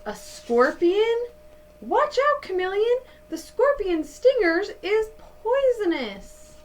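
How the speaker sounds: high, loud urgent call; calling out to the chameleon about the scorpion; alarmed warning. Acted. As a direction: shout a warning to a friend.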